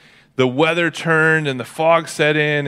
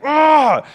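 put-on disappointed kid groan; 13 semitones higher, 6 dB louder than usual.

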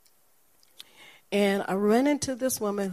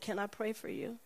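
gentle offer; 10 dB quieter than usual. Retold or imitated.

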